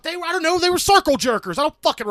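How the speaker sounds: loud, very high flustered protest; exasperated outburst. Real, not acted. Co-host badgered about their jobs, exasperated outburst.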